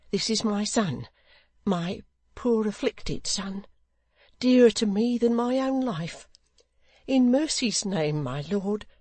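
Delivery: high, earnest mother's plea; pleading widow. A narrator's reading. Widow pleads for her son.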